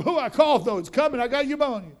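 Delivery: high, loud, panicky complaining; mock panicky whine. To his wife, insisting he's sick: high panicky whine. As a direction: play up the sick patient's fear, whining high. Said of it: "I'm like".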